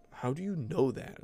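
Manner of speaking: slightly high, mild mocking challenge; toned-down jerky girl imitation. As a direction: lightly imitate a snotty girl's question. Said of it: "She kinda did say it like that".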